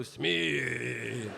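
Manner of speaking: Smith stretched long in mock Japanese accent; exaggerated Japanese-style Smith. Shown as a demonstration. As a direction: drag out Smith as a caricatured Japanese word. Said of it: "it's Japanese".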